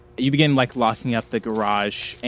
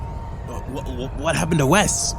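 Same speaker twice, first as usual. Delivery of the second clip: stammer, then rise to a higher question; stammering alarm; 4 semitones higher than usual. Acted. Jack asks after his floating friend, stammering alarm. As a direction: stumble into a shocked question.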